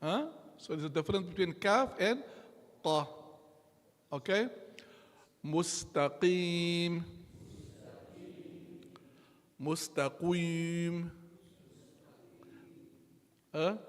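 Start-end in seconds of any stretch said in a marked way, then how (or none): 5.4-11.1 "Mustaqim" spelled out very slowly syllable by syllable; slow model. Shown as a demonstration. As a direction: stretch "Mustaqim" slowly for students to copy. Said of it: "teacher models the word very slowly".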